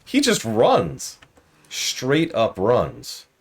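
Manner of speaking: slow, deliberate deadpan punchline. Real, not acted. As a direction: spell it out, word by word. Punchline about Cochrane fleeing.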